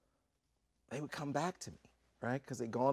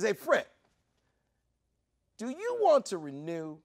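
loud, raised challenge; high, forceful question; pointed hail; 10 semitones higher, 7 dB louder than usual. Retold or imitated. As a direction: put him on the spot from across the room. Host calls out, confronts guest.

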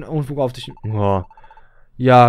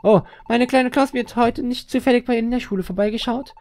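high-pitched put-on mother voice, anxious question; worried mother; 11 semitones higher than usual. Mother asking the teacher after her child, worried put-on voice. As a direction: play a fretting mum in a high voice.

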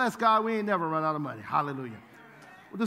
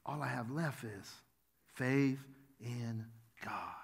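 breathy whispered "faith in God", much softer and slow; 9 semitones lower, 11 dB quieter, slower than usual. Real, not acted. Preacher to congregation, hushed whisper on the key truth. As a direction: drop to a hush to land the point. Soft close of sermon point.

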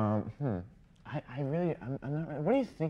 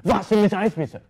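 loud, higher, barked German; mean-sounding German; 7 semitones higher, 10 dB louder than usual. Shown as a demonstration. Guest proving German sounds mean, barked German. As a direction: make a polite order sound harsh in German. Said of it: "anything they say just sounds very mean".

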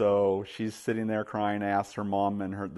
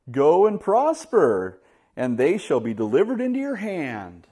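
mock-sincere sarcasm; 6 semitones higher, 6 dB louder than usual. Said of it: "obvious sarcasm".

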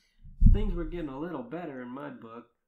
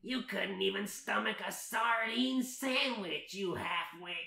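high, slow, scornful character voice; hammed-up scornful cackler's delivery; mocking villain; 6 semitones higher, 6 dB louder, slower than usual. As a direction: sneer the insults, stretching them out. Rita mocks Merida in story.

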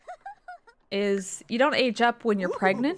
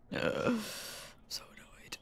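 softer whispered I'm so annoyed; whispered complaint; 12 dB quieter, slower than usual. Real, not acted. Streamer whispering that she's annoyed. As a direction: breathe the words out, voiceless.

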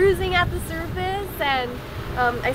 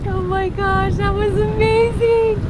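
breathless wonder, slower and higher; excited; 5 semitones higher, 6 dB louder than usual. Real, not acted. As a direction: gush with real amazement. Thrilled after whale swim.

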